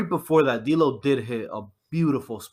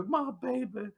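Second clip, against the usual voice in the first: high, soft imitation of her wail; mock wailing mother; 10 semitones higher, 6 dB quieter than usual. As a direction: pitch it up, imitating her cry. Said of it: "all you heard was her".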